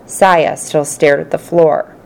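ordinary delivery, nothing marked

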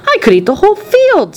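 put-on high, gleeful old man's exclamation; delighted grandpa. A narrator's reading. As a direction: crow it with a delighted grin. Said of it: "grinning with delight".

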